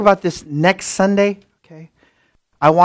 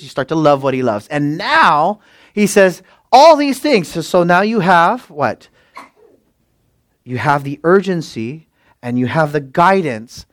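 usual speaking voice

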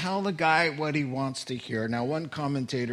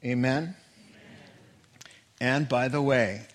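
normal talking voice

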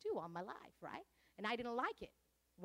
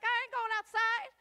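much higher, louder defiant protest; defiant refusal; 10 semitones higher, 10 dB louder than usual. Retold or imitated. Preacher refusing to smoke outside in the freezing cold, loud. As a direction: refuse outright, indignant. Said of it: "I'm like".

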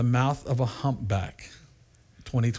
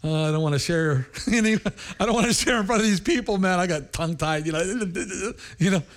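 timid, hesitant, fast and higher, with a nervous laugh; nervous mock stammer; 6 semitones higher, faster than usual. Pastor in India mocking his own nerves, nervous and giggly. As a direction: play yourself scared to preach, tripping over words. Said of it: "And I'm like".

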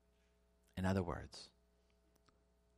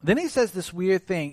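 ordinary delivery

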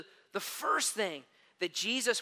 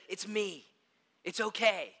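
gentle reassurance; 2 semitones lower, slower than usual. A narrator's reading. Jesus calms frightened disciples.

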